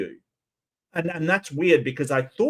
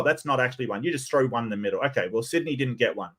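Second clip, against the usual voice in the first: ordinary talk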